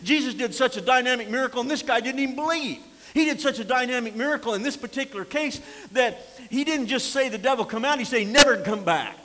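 loud, high, hammering sermon delivery; preaching at full force. Acted. Preacher warning the congregation about returning spirits, full-force preaching. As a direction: preach it like a revival. Preacher driving home a point.

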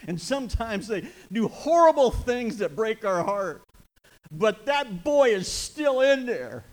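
loud, high, slow impassioned sermon; full-force revival preaching; fiery preaching. Real, not acted. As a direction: preach it hard from the pulpit.

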